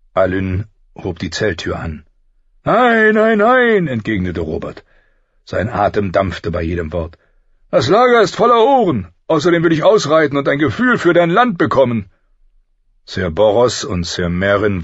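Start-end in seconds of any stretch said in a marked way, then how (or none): 2.6-3.9 high, booming string of refusals; loud refusal. A narrator's reading. King turning down an invitation inside, loud refusal. 7.7-12.1 high, booming royal voice; big commanding voice, full of energy; hearty loud king. A narrator's reading. King urging his friend to ride out, hearty and loud.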